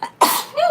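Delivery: sudden sharp sneeze; a real sneeze bursting out; sneezing. Real, not acted. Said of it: "Hold on, I have to sneeze".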